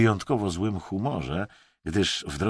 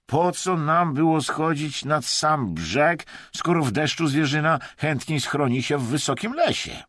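high, raised-voice resentful protest; hateful complaint; 7 semitones higher, 4 dB louder than usual. A narrator's reading. Vadim protests the riverside stakeout, resentfully. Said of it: "świszczał nienawistnie Wadim".

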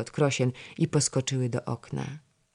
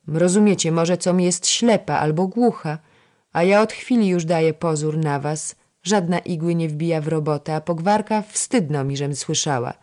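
raised-voice telling-off with mild anger; angry scolding; 3 semitones higher, 6 dB louder than usual. A narrator's reading. Senior lady-in-waiting warning girls she is watching them, angry. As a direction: reproach them, louder and firmer. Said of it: "rzekła z gniewem".

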